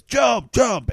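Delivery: loud, high imitated rescuer's command; booming shout. Podcaster acting Frankenstein urging people out the window, booming shout. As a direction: call it out like a booming firefighter. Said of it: "he's got a, this booming voice".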